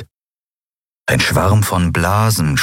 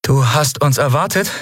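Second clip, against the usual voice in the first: 1 misstrauisch; 8 semitones higher than usual. Acted. Endriel fragt die Maske.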